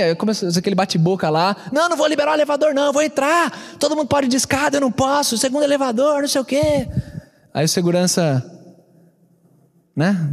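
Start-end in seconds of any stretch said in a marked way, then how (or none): 1.7-6.9 fast retold ranting. Retold argument, refusing to yield.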